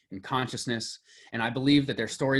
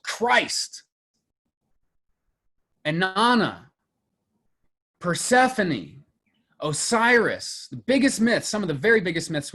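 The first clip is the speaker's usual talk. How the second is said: emphatic build; 5 semitones higher, 5 dB louder than usual. Real, not acted. Emphatic list of great myths.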